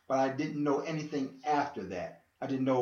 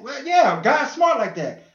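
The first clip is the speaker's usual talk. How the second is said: loud, slow exasperated burst; exasperated outburst about God; shouted aside; 10 semitones higher, 8 dB louder, slower than usual. Real, not acted. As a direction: throw it out loud and high. Frustrated aside, shout heard.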